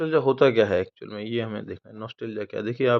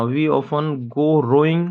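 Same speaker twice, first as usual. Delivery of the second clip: slow example sentence; 4 dB louder, much slower than usual. Shown as a demonstration. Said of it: "चप्पुओं का नाव से खेना".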